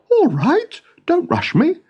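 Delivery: put-on a young king fretting over his birds.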